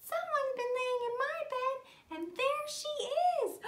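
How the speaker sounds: very high, squeaky little voice; tiny bear. Acted. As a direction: pipe up like a wee bear cub. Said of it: "tiny bear".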